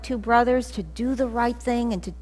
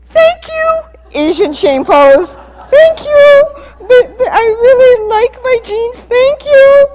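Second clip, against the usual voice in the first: high, loud, tearful panicked thanks; frightened pleading; 13 semitones higher, 16 dB louder than usual. Retold or imitated. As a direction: beg forgiveness like a scared kid. Terrified child thanking mom.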